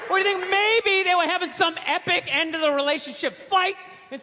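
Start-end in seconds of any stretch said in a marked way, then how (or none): none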